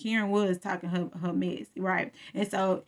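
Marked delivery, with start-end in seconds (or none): none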